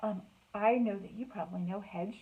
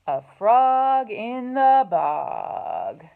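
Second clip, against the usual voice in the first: slow, loud title read; grand title announcement; 2 semitones higher, 13 dB louder, much slower than usual. Acted. Reading the book title aloud to the children, slow and loud. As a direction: announce the title like a show opener.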